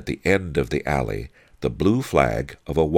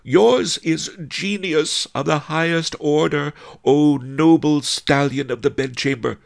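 high, gushing wife's voice, getting louder; adoring wife; 13 semitones higher, 5 dB louder than usual. A narrator's reading. Fat Fu praising her husband's genius, high and gushing. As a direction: gush with extravagant admiration, high-pitched.

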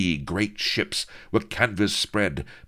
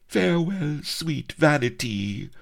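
nose held; 11 semitones higher than usual. Acted. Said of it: "she enunciated with some difficulty".

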